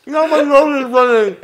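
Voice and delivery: laughing through a burning mouth; pained laughter. Real, not acted. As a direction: laugh while the chili burns. Laughing through the chili burn.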